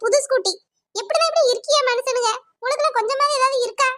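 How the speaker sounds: a hint of an indignant neighbour complaining; scolding neighbour. Neighbour scolds cow owner over her broken scooter, indignant.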